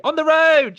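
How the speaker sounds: high, loud sign-off yell; catchphrase shout. Retold or imitated. Host shouting the guest's channel catchphrase back to him, loud and high. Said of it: "That was spot on".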